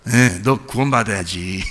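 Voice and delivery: low, soft and slow; tender father. Retold or imitated. Father tells elder son softly he needs saving. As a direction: tell him gently he must be saved. Father's meaning voiced slowly.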